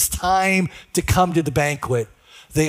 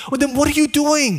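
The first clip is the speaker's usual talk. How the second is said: exasperated partner; 6 semitones higher than usual. Retold or imitated.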